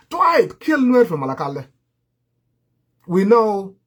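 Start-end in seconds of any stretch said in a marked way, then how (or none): 0.1-0.6 defiant shouted taunt; shouted dare. Real, not acted. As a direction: fling the dare right at him.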